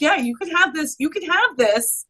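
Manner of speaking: lightly voiced cheery spirits; mock spirits' voice. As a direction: toss it off like the spirits promising everything. Spirits offering gifts, mock voice.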